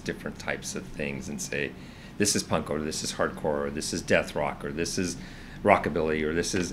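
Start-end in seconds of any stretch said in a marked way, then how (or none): none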